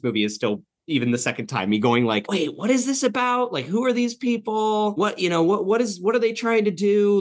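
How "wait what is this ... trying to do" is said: high, flustered string of questions; acted confusion. Host replaying their confusion at a rewatch, bewildered. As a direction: pile up baffled questions, pitched high. Acts out own confusion as viewer.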